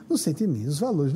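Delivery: ordinary talk, nothing marked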